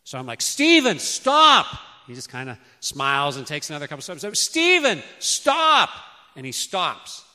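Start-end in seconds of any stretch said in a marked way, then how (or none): 0.4-1.7 high, loud cry of alarm; father yelling at toddler heading into the road; urgent shout. Retold or imitated. Child walking toward oncoming car. 4.4-5.9 high, loud cry of alarm; father yelling at toddler heading into the road; urgent shout. Retold or imitated. Child still walking toward car.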